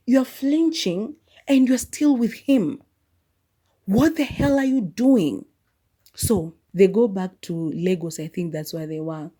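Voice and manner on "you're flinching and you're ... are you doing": high, loud, slow disbelief; slowed, raised cry of what the hell; exasperated outburst. Retold or imitated. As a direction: yell at the screen in disbelief. Shouting at the character on screen.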